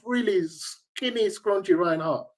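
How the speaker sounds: normal talking voice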